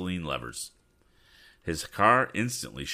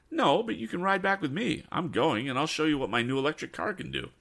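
put-on higher, brisk boyish voice; eager young Tom; 10 semitones higher than usual. Tom invites Ned to ride home, higher and quick. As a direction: offer the ride with a bright, hurried eagerness.

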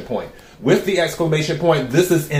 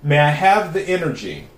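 invocation. Acted. Invokes cards while shuffling.

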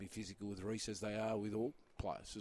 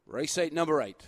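loud, high race call; race caller voice; 6 semitones higher, 10 dB louder than usual. Shown as a demonstration. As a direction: call it like a track announcer. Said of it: "I'm trying to put on my race caller voice".

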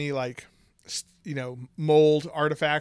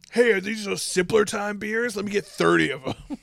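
louder, higher mock customer voice; mock tipsy shopper; 7 semitones higher, 6 dB louder than usual. Buzzed shopper asking for thirty beers, loud mock eagerness. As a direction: play an eager hammered shopper. Plays a hammered shopper at the store.

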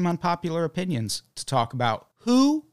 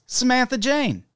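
ordinary delivery

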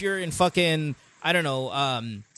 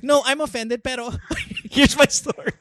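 bluntly honest; 7 semitones higher, 3 dB louder than usual. Retold or imitated. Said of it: "She's like".

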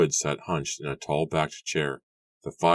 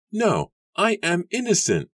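high, loud protest of innocence; emphatic declaration; 5 dB louder than usual. A narrator's reading. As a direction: declare it forcefully, pitched high. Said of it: "Susanna declared".

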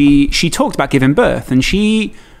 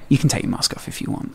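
hushed midwife; 9 dB quieter than usual. Retold or imitated. Midwives quietly offering.

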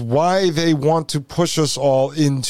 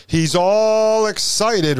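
drawn-out ALL, higher and slow; mock excitement; 6 semitones higher, slower than usual. Host mocking the tech salesman's pitch, mock excitement. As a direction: stretch ALL in mock enthusiasm.

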